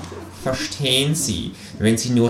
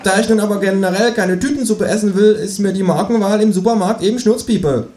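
higher, smug know-it-all rant; kangaroo lecturing; 11 semitones higher, 4 dB louder than usual. Acted. The kangaroo mocking the election to the narrator, smug lecture.

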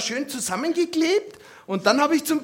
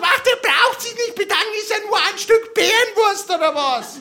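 much higher, sugary shop-lady voice; saleswoman voice; 8 semitones higher than usual. Retold or imitated. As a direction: play the meddling butcher's assistant. Saleswoman chimes in sweetly. (hohe, zuckersüße Verkäuferinnenstimme)

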